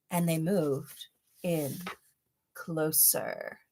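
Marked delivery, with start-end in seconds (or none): none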